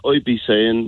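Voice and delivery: normal talking voice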